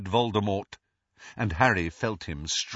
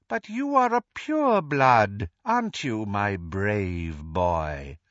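silky coaxing villain; 2 semitones lower, slower than usual. Acted. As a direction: tempt the boy with false praise. Voldemort coaxes Neville.

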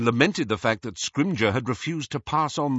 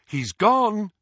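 high, raised call; 11 semitones higher, 4 dB louder than usual. A narrator's reading. Mother calls back from the back door, calling out. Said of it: "she called".

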